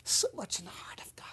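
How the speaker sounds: soft breathless whisper. Real, not acted. Preacher whispering to listeners about God's heart. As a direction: breathe it out unvoiced.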